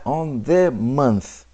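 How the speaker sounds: slow, leaning hard on the in On the month; stress on the. Shown as a demonstration. Teacher showing the class that the Torah says the month. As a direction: drag out the so the article stands out. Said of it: "it emphasizes the".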